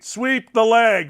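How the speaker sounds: ordinary talk, nothing marked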